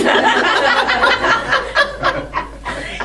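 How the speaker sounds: a burst of high loud laughter. Real, not acted. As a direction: laugh hard at the memory. Laughing at flustered phone call.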